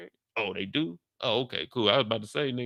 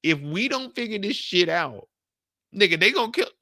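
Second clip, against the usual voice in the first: much higher, frantic mock panic; higher and higher, building to a yell at the end; imitating scared Jenny; 6 semitones higher than usual. Jenny begging the crew to stop, frantic mock panic. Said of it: "She said".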